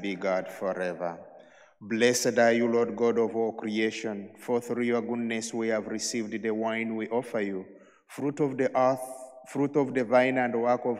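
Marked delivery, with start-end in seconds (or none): none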